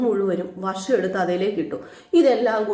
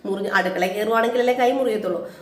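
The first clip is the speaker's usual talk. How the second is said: ordinary delivery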